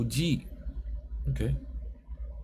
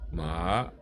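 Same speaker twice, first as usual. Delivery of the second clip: "ma" starting low and rising; second tone; 4 semitones lower than usual. Shown as a demonstration. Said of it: "Second tone".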